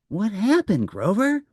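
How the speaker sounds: a worried friend asking; Prairie Dawn voice. Acted.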